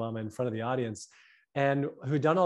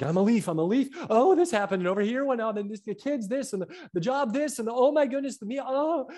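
mock panicky babble, higher and rising as it piles up; frantic overwhelmed person; 8 semitones higher than usual. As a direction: play someone swept away by every little thing. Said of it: "they're like".

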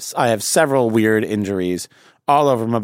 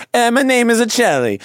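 high-pitched mock Italian accent; playful, raised Italian voice; 9 semitones higher than usual. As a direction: answer in a put-on Italian accent. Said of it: "the Italian accent is really".

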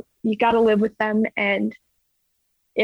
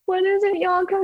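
high, anxious question; 9 semitones higher than usual. Retold or imitated. Asking her coach, near tears.

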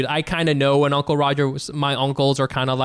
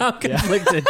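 laughing echo; 4 semitones higher, slower than usual. Retold or imitated. Repeats quote laughing.

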